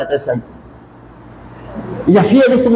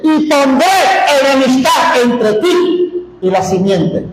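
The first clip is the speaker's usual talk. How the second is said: voice of God; 5 semitones higher, slower than usual. Acted. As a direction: thunder the words of God. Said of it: "Dios dice". (proclamando las palabras de Dios, alto y lento)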